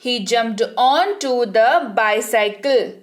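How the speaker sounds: slow, word-by-word model sentence; slow dictation. Shown as a demonstration. Teacher repeating for students, slow and clear. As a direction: spell it out slowly for the class. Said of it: "teacher repeats model sentence".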